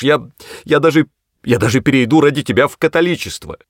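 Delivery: wailing plea. A narrator's reading. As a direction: plead as if all is lost. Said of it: "запричитал". (причитая, высоким голосом)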